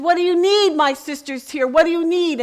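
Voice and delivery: high, loud calling out; shouted question. Retold or imitated. Preacher calling out to the women prisoners, high and loud. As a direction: shout it across the room to them. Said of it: "I shouted out to the women one more time".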